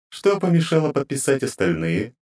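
high, grating divine voice; cold goddess interrogating her servants; inhuman goddess voice. Acted. Goddess questions.

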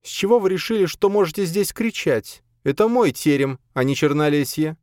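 high-voiced imperious mistress; stern, cool rebuke from the lady of the house; dry reprimand. A narrator's reading. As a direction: put them in their place, high and stern. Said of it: "сухо осведомилась".

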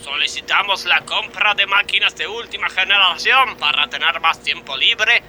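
high, declaiming demands; protest proclamation. Acted. Striking worker declares demands to the boss. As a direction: proclaim it like a union manifesto.

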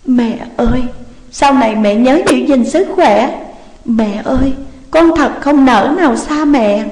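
narrated tearful parting from a mother figure; choked up. A narrator's reading. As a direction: say a tender goodbye, holding back tears. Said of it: "nghẹn ngào nói".

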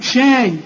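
"شاي" with a thin, fronted a; light sh. Shown as a demonstration. Teacher giving an everyday example, light sh. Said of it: "كل شين في القرآن شا".

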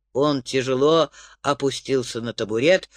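ordinary delivery

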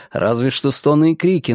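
ordinary delivery

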